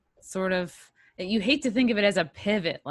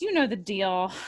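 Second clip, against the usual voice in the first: usual speaking voice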